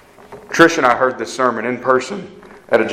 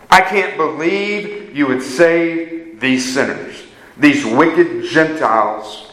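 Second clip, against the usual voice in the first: self-righteous complaint; 3 semitones higher, slower than usual. Acted. Preacher voicing self-righteous Jonah.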